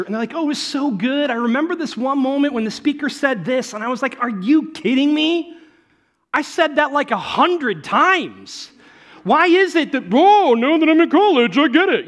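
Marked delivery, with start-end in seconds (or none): 0.3-3.7 gushing. Retold or imitated. Student gushing about college. 4.2-12.1 loud, high exasperated disbelief; exasperated mock outburst. Youth pastor to students who finally get it, loud exasperation.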